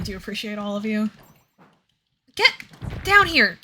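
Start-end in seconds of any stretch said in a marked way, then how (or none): 2.3-3.6 yelling. Real, not acted. Yelling at game character.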